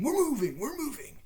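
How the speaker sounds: very high-pitched mocking imitation; mock indignation. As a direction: mimic a complainer, voice shooting up. Mimics man's repeated indignant announcement.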